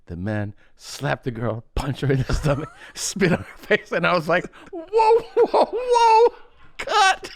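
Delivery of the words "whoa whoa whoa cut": mock-alarmed high calls to halt; high-pitched, laughing retold protest; alarmed director. Retelling director halting a violent scene.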